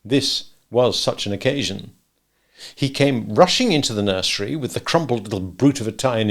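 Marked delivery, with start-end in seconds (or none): none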